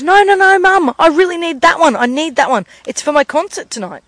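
put-on high, loud protesting kid; worked-up child pleading; pleading daughter. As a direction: beg mum urgently, starting high and easing down. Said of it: "So her daughter goes".

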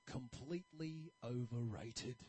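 low, slow, dismissive sneer; lightly voiced grumpy barber; sour barber. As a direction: drag it out with disgust. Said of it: "really negative sort of outlook".

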